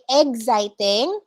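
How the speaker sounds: exciting said slowly as "eg-siting"; exciting drawn out with a g sound; correct pronunciation demo. Shown as a demonstration. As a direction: say exciting starting like egg. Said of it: "As in egg".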